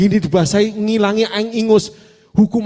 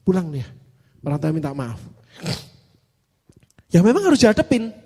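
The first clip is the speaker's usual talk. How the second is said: normal talking voice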